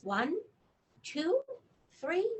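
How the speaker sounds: lively storybook reading; reading to children. Acted. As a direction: read the news of the bug to a child. Reads bedtime book aloud.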